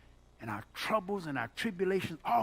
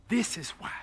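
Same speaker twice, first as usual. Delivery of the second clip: ordinary delivery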